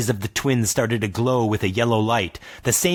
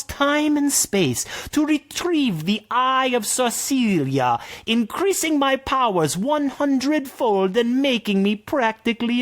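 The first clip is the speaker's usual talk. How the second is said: sorceress voice; 11 semitones higher than usual. Acted. Sorceress continues.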